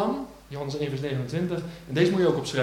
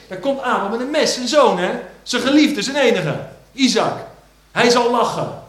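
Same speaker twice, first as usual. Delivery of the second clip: full-force preaching; 8 semitones higher, 10 dB louder than usual. Acted. Preacher to the church about John the Baptist, booming. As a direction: thunder it over the congregation. Dramatic climax of Abraham story.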